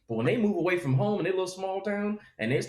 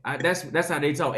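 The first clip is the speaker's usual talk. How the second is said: ordinary talk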